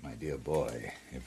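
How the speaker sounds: put-on older villain patronising the hero; condescending. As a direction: talk down to him gently.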